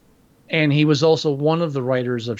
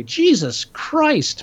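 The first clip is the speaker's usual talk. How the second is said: exasperated groan; 9 semitones higher, much slower than usual. Real, not acted. Exasperated at a cliche film.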